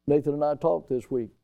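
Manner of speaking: usual speaking voice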